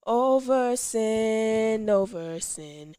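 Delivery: ordinary delivery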